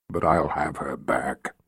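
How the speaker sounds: low rumbling emperor voice. Acted. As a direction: rumble like bottled thunder. Jagang's menacing vow.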